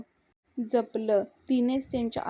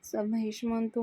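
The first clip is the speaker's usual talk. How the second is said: ordinary delivery, nothing marked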